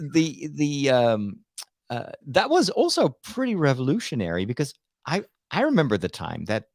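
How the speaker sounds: ordinary talk, nothing marked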